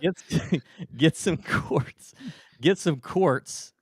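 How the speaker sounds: loud, high, laughing Pennsylvania accent. Shown as a demonstration. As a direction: repeat it big in the local accent, through laughter. Repeating accented sentence amid laughter.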